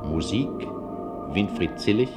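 ordinary delivery

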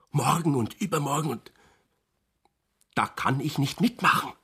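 ordinary talk

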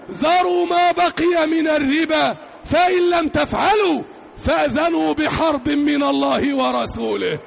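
ordinary delivery

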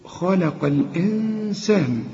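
slow tajweed recitation of الإنسان with the nun hidden and nasalized; Quranic recitation. Shown as a demonstration. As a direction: recite خلق الإنسان slowly, hiding the nun with ghunna. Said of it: "النون إحنا قلنا اللي بدون تشكيل".

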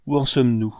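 pressing officer's question; brusque. A narrator's reading. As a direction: demand a report abruptly. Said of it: "demanda-t-il brusquement".

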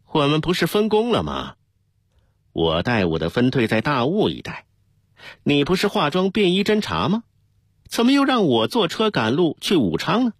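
high-pitched, challenging questions; indignant objection. A narrator's reading. Deputy disputes the captain's marching order, indignant and high-pitched. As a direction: push back on the order, pitch climbing.

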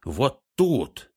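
sharp high shout. A narrator's reading. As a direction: point and shout it. Golem shouts pointing.

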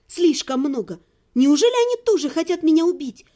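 usual speaking voice

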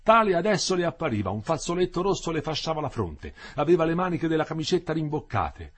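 plain reading voice

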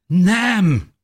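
high, loud refusal; protesting cry. A narrator's reading. Husband refuses to let his wife stay, crying out. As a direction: burst out in protest. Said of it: "tiltakozott a férje".

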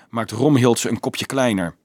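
ordinary delivery, nothing marked

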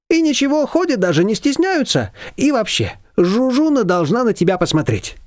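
high, lively neighbour voice; Shushana's voice. A narrator's reading. Neighbour brushing off Nina, high and bossy.

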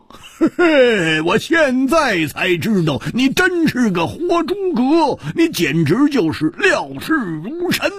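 high, rueful chuckling praise; high, bitter-amused marvel; wry laugh. Acted. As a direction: laugh at yourself while praising. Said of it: "苦笑着说".

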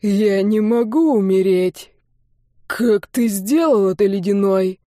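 dying sorcerer, stunned and bewildered; drawn-out, baffled disbelief; bewilderment. A narrator's reading. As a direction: struggle to grasp your own defeat. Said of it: "В голосе колдуна послышалось недоумение".

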